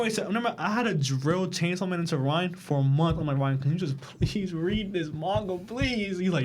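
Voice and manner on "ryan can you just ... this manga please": drawn-out imitation of begging; mock pleading. Friend begging Ryan to read a manga, mock pleading. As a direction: plead slowly, pitch rising on the last please. Retelling how he begged Ryan.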